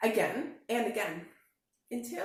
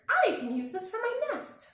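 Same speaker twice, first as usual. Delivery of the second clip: very high, loud birdy voice; chirpy bird; 14 semitones higher, 7 dB louder than usual. Acted. Swoopy bird claiming the hat for her nest, very high.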